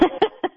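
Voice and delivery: high-pitched chuckle; short, high giggle of amusement; amused laugh. Real, not acted. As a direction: laugh lightly at the teasing. Amused by the host's teasing.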